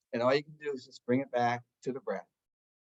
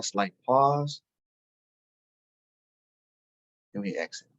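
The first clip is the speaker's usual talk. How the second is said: guided meditation; 2 semitones lower than usual. Acted. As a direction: talk them gently into stillness. Leads a meditation.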